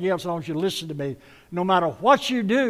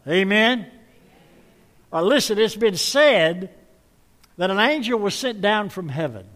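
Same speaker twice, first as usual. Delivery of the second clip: loud, raised call to the crowd; loud preaching call; 4 semitones higher, 6 dB louder than usual. Real, not acted. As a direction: rouse the congregation. Preacher rousing congregation.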